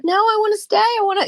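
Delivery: put-on high pleading child voice; high, whining child plea; whiny kid. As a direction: beg like a little kid who wants to stay. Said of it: "the kid was like".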